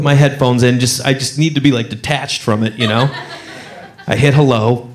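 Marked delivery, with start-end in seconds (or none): none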